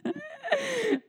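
squealing laugh; giggling at herself in the chat; very high squeal of laughter. Real, not acted. Laughing at own story.

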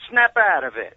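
playful shout, punched out; mock scolding yell. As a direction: imitate a wife jokingly yelling a command. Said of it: "smack me in the back and go".

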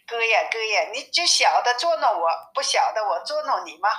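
plain reading voice

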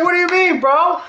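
hammed-up fanboy insistence; mock indignant. Fan defending John Cena to the hostile crowd, hammed-up outrage.

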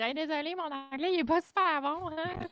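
imite ses propres excuses, en montant plus aigu; excuse gênée imitée. Retold or imitated. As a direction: se moquer de son réflexe de s'excuser, voix plus haute. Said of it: "j'ai le maudit tic de dire". (imitation moqueuse de ses excuses gênées)

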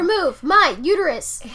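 loud joking outburst; mock shout. Joking with friend, loud mock shout. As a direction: blurt it out loud.

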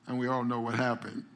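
ordinary delivery

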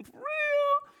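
high falsetto for real; squeaky disbelief. Retold or imitated. Speaker asking God in a high squeak. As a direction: pipe it up in disbelief. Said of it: "I'm like".

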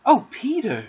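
high, eager exclamation; crying out. A narrator's reading. Wendy wishing to see the fairy, eager cry. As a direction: plead his name with longing. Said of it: "she cried".

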